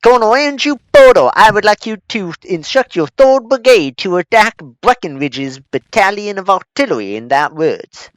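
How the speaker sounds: loud pompous Southern officer; southern accent. Pretend. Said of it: "I shouldn't have a southern accent when doing that".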